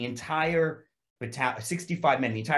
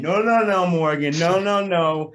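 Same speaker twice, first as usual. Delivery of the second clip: higher, louder pleading no no no; urgent restraining; 7 semitones higher, 9 dB louder, slower than usual. Retold or imitated. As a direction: hold a friend back from a fight. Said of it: "threw an arm around me and went".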